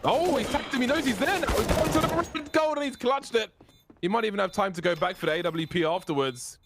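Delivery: esports caster. Real, not acted. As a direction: scream the clutch like a caster. Caster hypes a clutch play.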